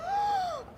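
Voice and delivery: sharp high gasp. Acted. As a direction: gasp in offense. Sudden gasp.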